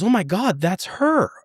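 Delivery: high, frantic shock, acted out; retold scream. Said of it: "like, she screamed".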